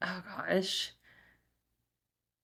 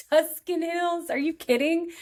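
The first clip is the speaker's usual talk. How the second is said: excited gush; 9 semitones higher than usual. Real, not acted. Gushing over sidecar ride.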